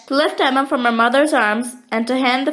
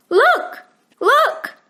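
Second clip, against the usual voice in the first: put-on excited child; 12 semitones higher than usual. A narrator's reading. Emma jerks upright and points.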